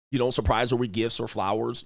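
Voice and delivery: ordinary delivery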